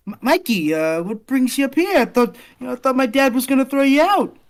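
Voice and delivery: high, loud, stammering surprise; flustered greeting. Acted. Girl surprised by old friend at her door, flustered.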